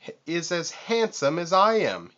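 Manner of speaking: high, loud shouting boast. A narrator's reading. Vulture shouts his vanity while jumping, high and loud. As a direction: yell your own praise. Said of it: "he shouted".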